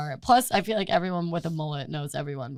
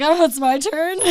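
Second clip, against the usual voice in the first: higher and louder, laughing through it; 8 semitones higher, 6 dB louder than usual. Real, not acted. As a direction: giggle out the words. Laughing recalling the diner.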